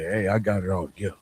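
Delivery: normal talking voice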